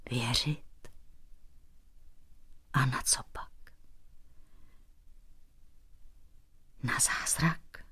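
put-on very soft, broken old king, near whisper; tearful despair. A narrator's reading. Bent old king rejects the painter's comfort, tearful despair. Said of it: "vykřikl plačtivě schrbený sivý král".

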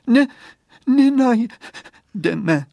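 put-on drawn out, hopeless little girl; sad girl. Girl tells friend the glasses are lost, very slow.

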